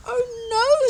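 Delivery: small mole seeing the trucks; dismayed Mole. Acted. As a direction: gasp it out in shock as the little mole. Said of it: "said Mole".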